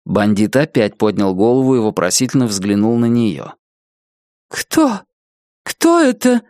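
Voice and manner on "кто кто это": high, thin, startled voice; frightened Nettie. Acted. Nettie, startled by the caller, high thin fright. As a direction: squeak it out in alarm.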